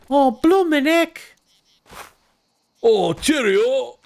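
high, loud mock British voice; mock English. Streamer parodying English chap hop lyrics, high cheery mock English. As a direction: chirp it like a cheery Brit. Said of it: "It's about tea".

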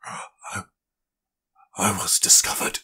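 breathless dying gasp. Acted. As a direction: gasp out words while wounded. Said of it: "Lothar gasped".

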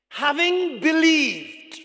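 slow, loud, high proclamation; slow reading. Real, not acted. Preacher reading Paul's verse aloud to church, slow reading. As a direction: draw the words out loudly. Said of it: "I'm gonna read it slowly".

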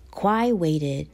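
ordinary delivery, nothing marked

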